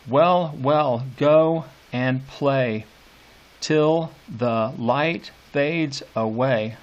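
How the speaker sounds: "Well, well" weighted, "go and play till the light fades away" slow and beat by beat; slow metrical read; scanning the meter. Shown as a demonstration. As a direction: tap out the rising meter. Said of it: "mark that as unstressed".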